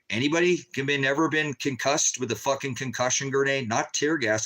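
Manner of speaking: normal talking voice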